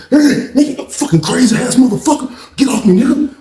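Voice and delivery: high-pitched mock fight yell; heated, high imitation of a brawl; retold angry outburst. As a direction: act out cursing someone off you. Retold shouting during the fight.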